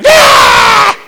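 high wordless yell. Retold or imitated. Yelling at a scam caller over Bluetooth, loud scream. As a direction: blast a sudden scream down the phone. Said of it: "and I went".

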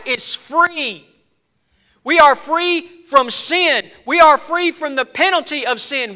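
high, forceful pulpit proclamation; slow-building, emphatic sermon voice; impassioned preaching. Real, not acted. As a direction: proclaim it, stretching the key words.

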